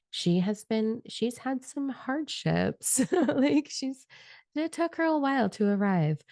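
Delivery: high, squeezed voice with a small laugh; emotional, choked up. Real, not acted. Recalling her younger self's hardships, overcome with emotion. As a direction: let the pitch climb as feeling takes over.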